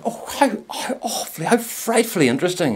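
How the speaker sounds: high, airy upper-class gushing; mock the snooty guests with exaggerated poshness; posh snob. Snooty party guest gushing over the bin man, in a high posh voice.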